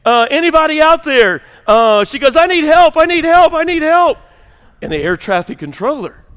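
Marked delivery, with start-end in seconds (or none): 0.0-1.4 high, loud hammed-up distress call; panicked mock screaming. Blonde passenger radioing for help in a joke, mock screaming. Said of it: "she just starts screaming over the airways". 2.3-4.2 high-pitched, loud comic plea for help; mock screaming. Blonde passenger pleading over the radio, hammed-up screaming. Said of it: "she just starts screaming over the airways".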